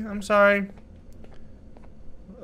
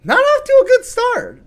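squeaky mock despair; 5 dB louder than usual. Real, not acted. Attacked seconds into game.

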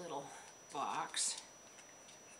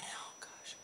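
whispered aside; 15 dB quieter than usual. Real, not acted. Aside while recalling trying rings.

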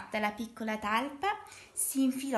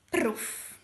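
loud, unvoiced "pruff"; imitated plop; 6 dB louder than usual. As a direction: blow out a short messy splat. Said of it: "Io la faccio così". (sbuffo imitato)

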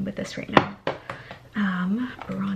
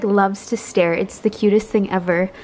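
ordinary talk

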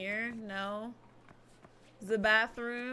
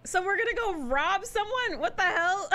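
higher-pitched, incredulous reaction; baffled, breaking toward a laugh; amused disbelief; 10 semitones higher than usual. Real, not acted. Gamer reacting to the robbery plan, amused disbelief.

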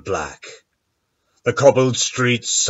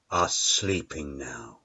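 hushed; 5 semitones lower, 9 dB quieter than usual. A narrator's reading. Town falls asleep, voice drops.

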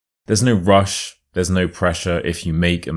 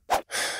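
relieved sigh; 11 dB quieter than usual. Acted. Relief after escape story.

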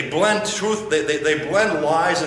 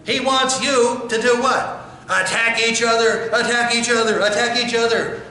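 rising urgency; 7 semitones higher than usual. Real, not acted. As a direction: hammer the words home. Preaching climax, repeated.

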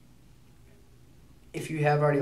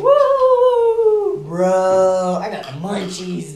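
stoner voice; 5 semitones higher, 11 dB louder, much slower than usual. Retold or imitated. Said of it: "They're talking about going".

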